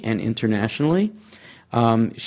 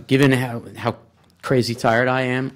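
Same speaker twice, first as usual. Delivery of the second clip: normal talking voice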